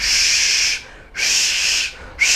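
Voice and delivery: স said as a hushed sh, repeated; sh sound. Shown as a demonstration. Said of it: "উচ্চারণ করতে হয় স এর মতো". (শ-এর মতো স)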